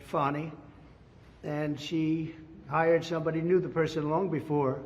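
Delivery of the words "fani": "Fani" said as FAH-nee; her own pronunciation. Retold or imitated. Speaker repeating the prosecutor's way of saying "Fani", with an "ah". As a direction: say "Fani" with an open "ah", not as in "fan". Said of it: "or as she would say".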